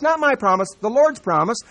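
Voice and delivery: loud, high, slow preacher reading scripture; full-force preaching. Acted. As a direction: thunder out the verses to the congregation.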